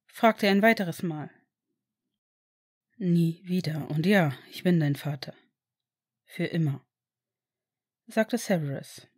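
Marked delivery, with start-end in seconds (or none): 3.0-6.8 gentle father. Acted. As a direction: promise him he is safe now. Father answers his son. (tiefer, ruhiger, beruhigender Vater)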